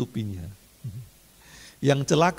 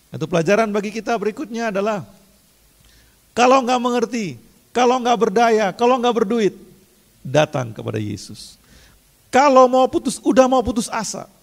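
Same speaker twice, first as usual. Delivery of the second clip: high, louder urgent sermon push; preaching at full force; 9 semitones higher, 7 dB louder than usual. Acted. Pastor urging the congregation not to waste grace, full preaching. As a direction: drive it home to the congregation.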